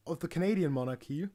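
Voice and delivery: normal talking voice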